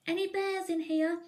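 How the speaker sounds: high, loud little bear's call; a small bear calling into the dark attic; high hopeful call into the attic. Acted. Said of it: "he whispered".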